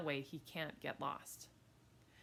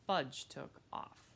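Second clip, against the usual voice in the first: usual speaking voice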